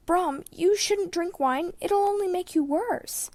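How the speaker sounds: put-on a worried boy begging his teacher not to drink; pleading youth. A narrator's reading.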